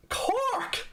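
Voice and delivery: put-on high, sing-song Cork accent; mock Cork accent. Streamer to viewer asking his home, in a mock Cork accent. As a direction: ham up a Cork accent in disbelief. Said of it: "My accent's very, very non-Cork".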